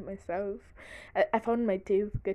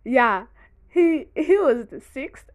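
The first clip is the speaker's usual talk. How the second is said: laughing; 4 semitones higher, 14 dB louder, slower than usual. Real, not acted. Retelling through laughter.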